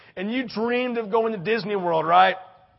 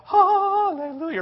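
high, louder, slow hallelujah; jubilant hallelujah; 11 semitones higher, 4 dB louder, slower than usual. Acted. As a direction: let it soar with relief. Child's inner joy.

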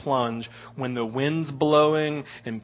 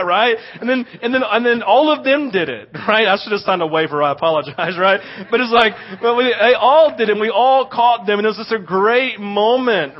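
usual speaking voice

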